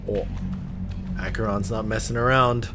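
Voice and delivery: ordinary delivery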